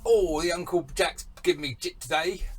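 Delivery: badly faked Cockney; crap Cockney accent. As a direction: put on a hammy Cockney accent. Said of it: "that's a crap Cockney accent".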